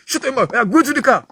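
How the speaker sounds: a high sharp scolding order, lightly acted; high mock scold from the mother; mother's sharp order. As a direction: imitate the mother snapping at her child. Mother snapping at child.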